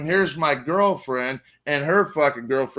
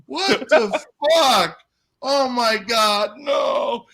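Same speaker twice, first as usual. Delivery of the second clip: shocked; 9 semitones higher, much slower than usual. Retold or imitated. Teller's own shocked reaction quoted.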